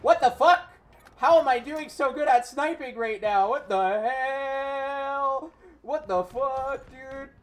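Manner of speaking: loud, high, disbelieving shout; stunned disbelief. Real, not acted. Gamer shocked at his own sniping, yelling in disbelief. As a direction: shout it like you can't believe your own luck.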